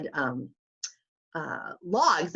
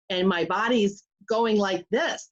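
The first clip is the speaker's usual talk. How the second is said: usual speaking voice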